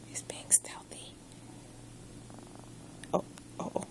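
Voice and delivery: hushed stealthy whisper. Real, not acted. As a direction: whisper so the cat won't hear. Whispering while cat hides.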